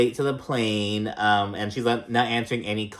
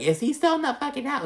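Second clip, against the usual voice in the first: imitating a cursing friend, much higher; mock outraged cursing; faster than usual. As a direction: shriek the question in mock outrage. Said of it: "like, cursing".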